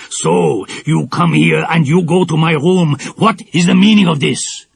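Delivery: loud, menacing demand; angry villain. A narrator's reading. Villain catching girls in his room, angry. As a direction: snarl it at the girls. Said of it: "said the man".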